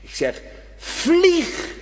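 high, slow stretched vlieg; preacher urging young and old, high and slow; urgent call. Acted. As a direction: lift and hold vlieg high. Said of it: "ik zeg vanavond tegen je".

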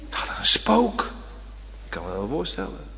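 soft, breathy eerie aside; hushed spook voice. Acted. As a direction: whisper it like a ghost story. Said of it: "eerie hushed aside".

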